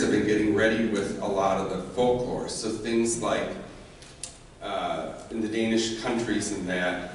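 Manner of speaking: plain reading voice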